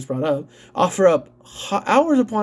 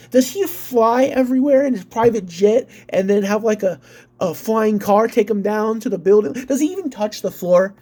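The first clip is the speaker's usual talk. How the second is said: normal talking voice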